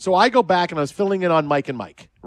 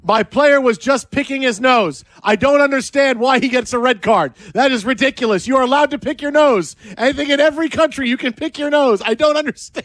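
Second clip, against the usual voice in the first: higher, louder indignant protest; mock outraged coach; 7 semitones higher, 4 dB louder than usual. Coach protesting to the referee, mock outrage. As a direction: play the coach wailing about an unfair red card.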